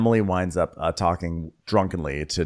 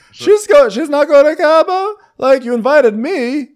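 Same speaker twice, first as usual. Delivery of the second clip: very high, loud indignant squeal; shocked outrage; 9 dB louder than usual. Retold or imitated. Woman upset she was left off the trip, shocked squeal. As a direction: squeal it in disbelief. Shocked friend about Cabo.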